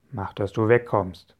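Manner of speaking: rough pirate bark; snarling pirate. A narrator's reading. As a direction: snap it like a bad-tempered pirate. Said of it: "schnauzte er".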